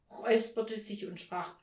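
ordinary delivery

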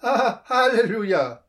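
high, loud slipped-out Halleluja; joyful outburst. A narrator's reading. Thief blurts out a joyful Halleluja, loud. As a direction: let it burst out unplanned. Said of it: "rutschte ihm plötzlich ein".

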